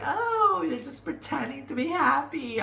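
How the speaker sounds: high sneering doubter voice, imitating a skeptic; mocking doubter. Answering doubting viewers, in a high mocking imitation. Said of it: "gonna be like".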